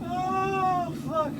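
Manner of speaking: high, slow, breathless from laughing; weak, high voice after hard laughter; worn out laughing. Real, not acted. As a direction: squeak it out at the end of a laughing fit. Recovering from a laughing fit.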